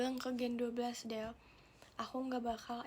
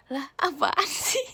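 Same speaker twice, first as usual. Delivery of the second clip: crying with laughter; 3 semitones higher, 9 dB louder, slower than usual. Real, not acted. Reacts to funny comment, crying laugh.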